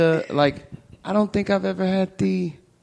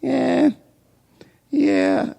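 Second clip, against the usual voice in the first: a touch higher, fumbling attempt at sexy talk; awkward dirty talk; 6 semitones higher, much slower than usual. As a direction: try to talk dirty and fail, trailing off. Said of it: "I just sound like a".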